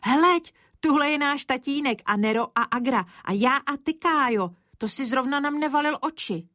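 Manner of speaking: put-on high, eager girl's "Heleď" and chatter; calling her brother to look; excited little sister pointing. A narrator's reading. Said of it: "Teď volala Zdeňa".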